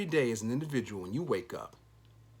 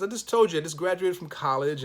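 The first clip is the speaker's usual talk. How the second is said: louder and higher, retold indignant protest; exasperated retort; 6 semitones higher, 7 dB louder than usual. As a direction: fire it back like he's already said it.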